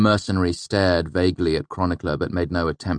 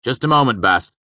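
higher, louder quick reply; distracted brush-off; 6 semitones higher, 7 dB louder than usual. A narrator's reading. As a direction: put off the one calling you. Brushing off an urgent caller.

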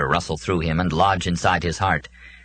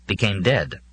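plain reading voice

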